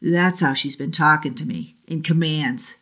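ordinary delivery, nothing marked

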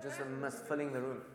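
ordinary delivery

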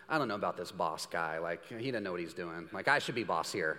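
low, soft smug grumble; arrogant new hire. Retold or imitated. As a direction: complain like a know-it-all newbie. New employee grumbling about boss.

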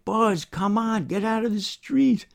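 high, urgent call; yelling at him. A narrator's reading. Driver yells at Buzz in the middle of the road. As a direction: call out a warning at a high pitch. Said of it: "I yell at him".

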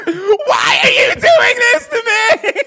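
high, loud, slow sobbing scream; crying scream. Retold or imitated. Storyteller imitates the rock star sobbing at the radio song. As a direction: scream and sob it like a meltdown. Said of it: "and she goes".

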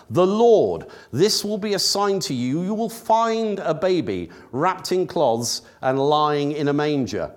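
ordinary talk, nothing marked

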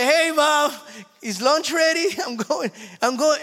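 very high, loud call to mom with a laugh; sing-song call from the basement. Retold or imitated. Grown son calls up to mom for lunch, high and loud.

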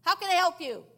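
loud, high, annoyed operator voice; irritated operator picking up at last; angry operator. Retold or imitated. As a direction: snap at the caller as a fed-up operator. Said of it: "this very angry voice".

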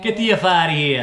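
loud, fast mock New Yorker; New York accent. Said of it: "New York".

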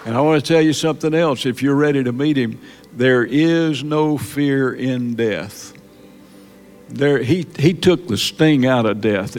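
ordinary talk

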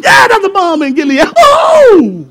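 loud, high mock excitement; mock hype. Preacher mocking hyped-up church folk, loud.

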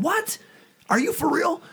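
very high, shocked what; jaw-dropping surprise, voice leaping up; stunned disbelief. Retold or imitated. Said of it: "And I said".

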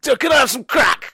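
high, hammed-up wrestler in the booth; put-on high voice making a request; The Undertaker. Playing Undertaker in recording booth.

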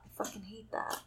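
breathy whispered admission; whispered confession. Real, not acted. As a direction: say it under your breath, ashamed. Confessing nail biting.

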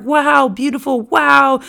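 slow, high, marveling wow; awed wow. Retold or imitated. Teacher imitating awe at Jerusalem's glory, high and slow. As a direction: gasp at the beauty. Admiring the glorious city.

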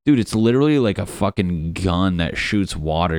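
ordinary talk, nothing marked

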